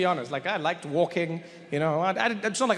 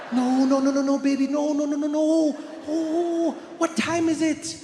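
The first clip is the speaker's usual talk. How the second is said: mock panicked mom; 10 semitones higher than usual. Mom to her forgotten kid, hammed-up panic. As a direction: wail it like a mom caught out.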